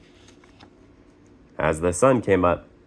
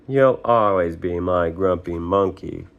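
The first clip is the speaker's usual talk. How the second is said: ordinary delivery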